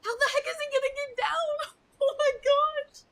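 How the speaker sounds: high, loud, laughing disbelief; real amused shock; laughing squeal. Real, not acted. Streamer laughing at hanged man.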